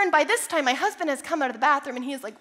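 normal talking voice

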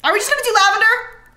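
high, loud and fast; exasperated. Real, not acted. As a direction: plead in exasperation. Exasperated pleading.

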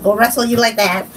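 usual speaking voice

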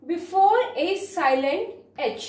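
slow dictation. Shown as a demonstration. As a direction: read the rule word by word. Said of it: "slow dictated rule".

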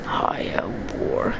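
low, slow whispered threat; menacing whisper. Acted. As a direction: breathe it like a villain. Ominous line in game.

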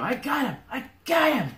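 high, hollered cry of triumph; excited yell. Acted. Ivis calls out to the party mid-fight, excited yell. Game master voices Ivis yelling.

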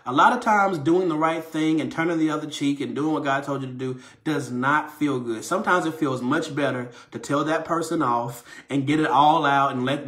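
normal talking voice

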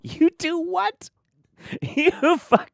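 incredulous high squeal; shocked squeak. Real, not acted. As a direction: squeal it out in disbelief at him, then laugh. Incredulous reaction.

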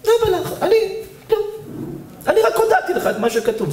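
high-pitched defensive squeal; defensive protest. Acted. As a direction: protest your innocence high up. Acted self-defence in an argument. (מחאה מתגוננת בקול גבוה)

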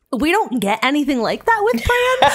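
high, loud laughing rant; can't stop laughing at it; laughing exasperation. Real, not acted. Laughing rant about Bran.

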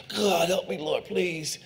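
soft, pleading whisper-like prayer; quiet plea. Retold or imitated. Preacher acting out his own prayer, soft pleading. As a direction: beg God softly, near breaking.

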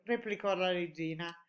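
ordinary delivery, nothing marked

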